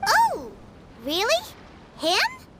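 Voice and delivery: high-pitched astonished questions; startled surprise. Acted. Listener reacts to news of the officer's visit, startled. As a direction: pitch leaps up in disbelief.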